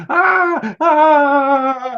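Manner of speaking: high-pitched starstruck squeal; imitating a screaming fan; mock fan scream. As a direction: shriek like a fan who was just touched by a star. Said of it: "I've seen women go up and scream".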